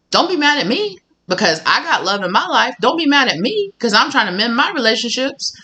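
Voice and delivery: sassy telling off. Acted. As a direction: put the hater in their place. Telling off a hater.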